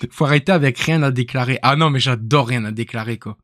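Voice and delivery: ordinary talk, nothing marked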